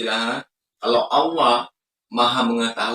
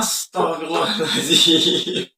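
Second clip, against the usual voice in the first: exasperated sigh; 4 semitones higher, slower than usual. Real, not acted. Exasperated reaction.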